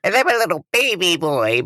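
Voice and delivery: put-on high little baby voice. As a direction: talk like a toddler. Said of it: "this is my baby voice".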